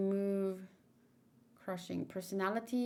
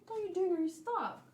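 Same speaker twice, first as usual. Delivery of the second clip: much higher sing-song pet voice; talking to a pet; 10 semitones higher, 3 dB louder, faster than usual. Real, not acted. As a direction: coo up high at the pet.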